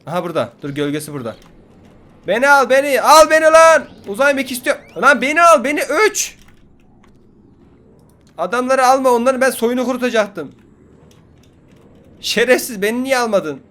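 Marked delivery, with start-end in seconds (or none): 2.3-13.6 very high, loud screaming begging; screaming plea. Real, not acted. Gamer begging the spaceship to take him, screaming. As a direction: scream at the sky to be taken.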